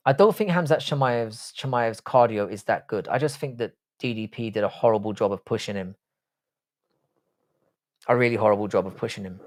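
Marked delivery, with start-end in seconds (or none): none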